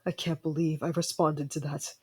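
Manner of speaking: ordinary talk, nothing marked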